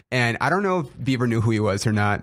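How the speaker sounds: ordinary talk, nothing marked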